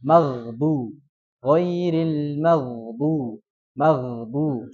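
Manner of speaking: maghdu with lips unrounded, gh plain. Shown as a demonstration. Teacher shows students the gh said with unrounded lips. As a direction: say maghdu without pushing the lips out. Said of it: "bibirnya sama".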